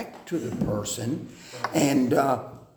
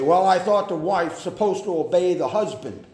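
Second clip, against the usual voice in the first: mock objection; 4 semitones higher, 6 dB louder, faster than usual. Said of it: "you say".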